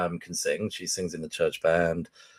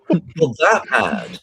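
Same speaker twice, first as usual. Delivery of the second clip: slow, higher, louder disbelieving question; amused disbelief; 7 semitones higher, 7 dB louder, much slower than usual. Retold or imitated. Wife learning how bad his singing is, slow amused disbelief. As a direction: stretch it out, half laughing.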